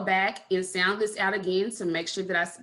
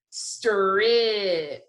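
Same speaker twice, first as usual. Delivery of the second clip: slow sounding out. Shown as a demonstration. Teacher sounding out a word for spelling, slowly stretched. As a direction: stretch "Strip" slowly through every sound. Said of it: "say it slowly again".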